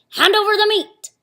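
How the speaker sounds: high loud hungry bark; pirate demand. Acted. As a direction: demand the meat loudly. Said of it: "Braidbeard gulped his food and said".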